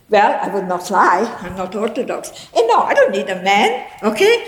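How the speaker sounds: ordinary talk, nothing marked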